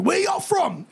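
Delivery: high, pushy "where y'all from"; tough kid challenge. Retold or imitated. Kid from Dorchester confronting the boys at the door, mock tough. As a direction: size them up like a street kid. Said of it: "he's like".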